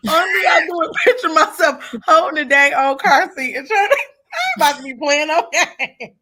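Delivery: words squeezed out through real laughter; laughing hard. Real, not acted. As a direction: laugh through the whole line, half crying. Laughing while picturing the car seat.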